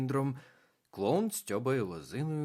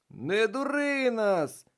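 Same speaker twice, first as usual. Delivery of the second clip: higher, louder slow crowd shout; 13 semitones higher, 8 dB louder, slower than usual. A narrator's reading. Ескімоси кричать на бабу Ягу, гучний вигук. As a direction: yell a warning together. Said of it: "кричали ескімоси".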